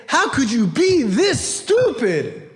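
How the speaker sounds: high, slow, incredulous rebuke; exasperated scolding. Retold or imitated. Father scolds son kicked out of school, exasperated scolding. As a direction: stretch it out in mock outrage.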